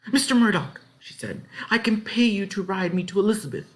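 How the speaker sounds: put-on high, eager girl's voice; high, earnest child; girl pleading. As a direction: offer the money, hoping. Ellen pleads.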